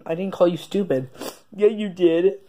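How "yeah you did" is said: usual speaking voice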